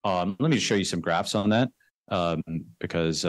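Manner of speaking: ordinary delivery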